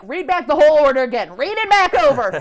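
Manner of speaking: building mock yell at the drive-thru; mock angry demand. Southern customer demanding her order read back, mock irate. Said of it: "we were arguing for, like, ten minutes".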